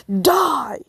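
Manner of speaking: loud, high attack yell; battle cry. Acted. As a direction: scream it as you charge. Kabuto attacks screaming.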